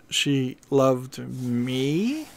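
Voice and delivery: slow, quiet disbelief that anyone could love you; self-deprecating read. Shown as a demonstration. Demoing the self-deprecating reading, slow and doubtful. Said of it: "self-deprecating".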